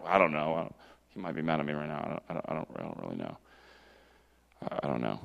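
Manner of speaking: low, soft, hesitant mumbling; unsure mumble. Pretend. A believer doubting God's welcome, mumbling low and unsure. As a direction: mumble it like someone afraid God is mad.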